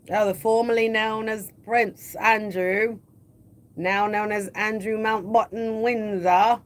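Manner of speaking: slow, mock posh British accent; hammed-up slow British accent; put-on British accent. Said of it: "the British accent almost in my throat out".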